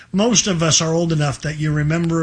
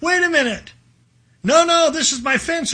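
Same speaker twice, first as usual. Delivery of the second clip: put-on excited boy calling stop; 10 semitones higher than usual. Acted Tom stopping friends painting.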